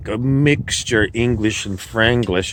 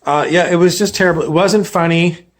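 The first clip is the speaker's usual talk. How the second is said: higher, louder, fast griping; exasperated complaint; 6 semitones higher, 4 dB louder, faster than usual. Real, not acted. Producer complains about the failed comedy bit, fast and exasperated. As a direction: vent about the flop, rushing.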